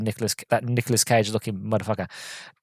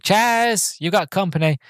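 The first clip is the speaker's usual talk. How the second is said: mum calling her son, pitched high; imitated calling out; 8 semitones higher, slower than usual. Old woman shouting to Chaz that he has company, imitated high call. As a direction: call out higher, mimicking a mother.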